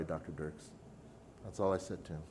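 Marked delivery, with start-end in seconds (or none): 0.0-0.7 quiet. Retold or imitated. Apologizing to the dean.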